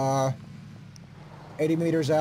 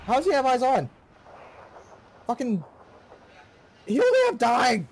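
high, loud angry disbelief; frustrated outburst; 8 semitones higher, 3 dB louder than usual. Real, not acted. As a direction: blurt it out in raging shock. Gets shot, furious disbelief.

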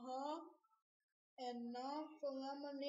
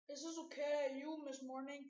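usual speaking voice

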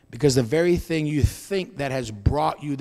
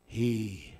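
hushed, slow whisper; a slow whispered assurance; 8 semitones lower, 15 dB quieter, much slower than usual. Real, not acted. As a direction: breathe it out, barely voiced. Quiet climax of sermon.

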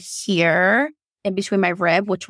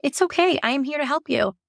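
gentle reassurance; 8 semitones higher than usual. Retold or imitated. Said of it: "And she said".

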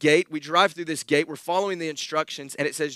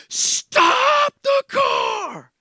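high, drawn-out scream of the wife, much louder; panicked scream; 5 dB louder, much slower than usual. Retold or imitated. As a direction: shriek it at the driver in terror. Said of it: "Megan is screaming at me like she's never screamed at me before".

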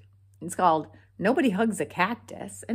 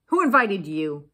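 loud, unwelcoming question; cross storybook cactus; grumpy challenge; 9 dB louder than usual. A narrator's reading. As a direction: challenge an unwanted guest. Grumpy cactus rebuffs visitor.

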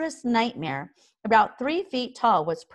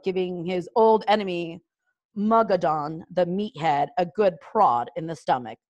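ordinary delivery

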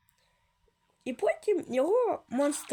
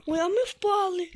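mock dismay; 5 semitones higher than usual. Dramatic fall exclamation.

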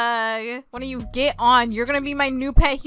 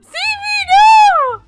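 very high, loud cry of protest; desperate scream; 12 dB louder, much slower than usual. Acted. Player loses pet human to a thief, desperate scream. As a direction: scream after someone being taken.

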